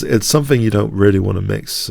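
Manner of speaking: ordinary delivery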